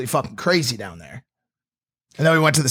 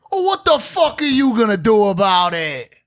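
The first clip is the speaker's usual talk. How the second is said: a touch louder, higher taunting challenge; mock tough guy; 5 semitones higher, 4 dB louder than usual. Heckler squaring up to Logan, taunting and louder. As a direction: sneer it back like a bar tough. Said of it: "the guy goes".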